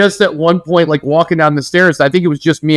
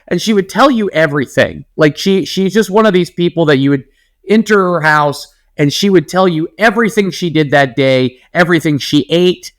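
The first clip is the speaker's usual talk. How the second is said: ordinary talk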